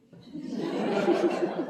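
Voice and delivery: breathy loud burst of laughter; real audible laugh. Real, not acted. Wife jokes about husband's questions.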